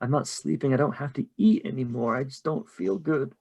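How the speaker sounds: soft, weak, bewildered lament; hushed, troubled murmur; distressed, tearful brother. Acted. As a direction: confess it quietly, close to tears. Dead brother repeats his distress.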